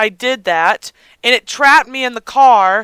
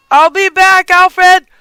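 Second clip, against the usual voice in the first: high, loud call to someone behind; raised parting shout; 7 semitones higher, 7 dB louder, slower than usual. Pretend. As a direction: call it back as you hurry off. Calling to butler while leaving.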